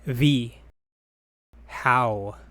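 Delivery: normal talking voice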